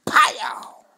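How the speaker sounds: a loud unvoiced pow like a slap; hammed-up slap noise; loud slap sound. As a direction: smack the air with a sharp pow. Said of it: "too, sounding".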